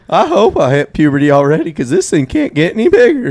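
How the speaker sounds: high, squeaky mock adolescent; climbing, squeaky puberty voice; cracking-voice kid. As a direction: play a boy whose voice is breaking. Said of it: "My voice might have cracked".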